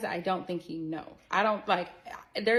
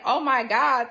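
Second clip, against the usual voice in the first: Oh my God blurted out much louder; shocked outburst, louder; loud gasp of shock; 5 semitones higher, 11 dB louder, slower than usual. Retold or imitated. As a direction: belt it out as the realisation lands. Sudden realisation, retold panic.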